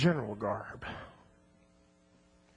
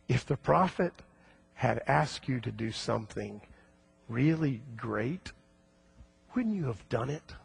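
gentle plea; 6 semitones lower, 8 dB quieter than usual. Acted. Servants gently reason with general.

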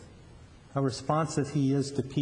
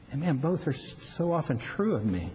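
ordinary talk, nothing marked

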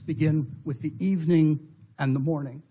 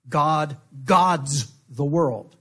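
God gods the world, each word slow, gods leaned on; slow stress on gods; much slower than usual. Shown as a demonstration. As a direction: lecturer dwelling on the rabbi's translation, slow stressed gods. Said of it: "as a rabbi translates it".

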